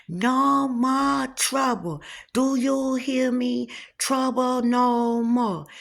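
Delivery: slow, high, solemn cook voice; cook's proclamation. Retold or imitated. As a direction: intone it slowly like a mystic cook. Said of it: "and he said".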